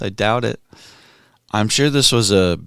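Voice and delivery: ordinary talk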